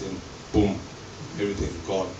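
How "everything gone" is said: usual speaking voice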